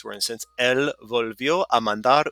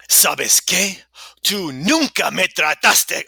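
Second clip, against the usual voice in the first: put-on loud, higher dramatic voice, short of girlish; wrong voice; 6 semitones higher, 6 dB louder, slower than usual. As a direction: act it out forcefully in your own voice. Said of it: "I'm in the wrong voice".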